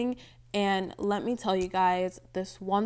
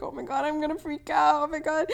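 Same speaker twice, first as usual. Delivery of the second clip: mock panic; 7 semitones higher than usual. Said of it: "she's seen that part of me where I'm like".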